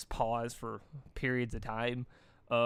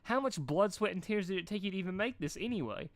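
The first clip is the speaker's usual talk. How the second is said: gushing praise; 6 semitones higher than usual. A narrator's reading. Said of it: "her heart leaping in utter happiness".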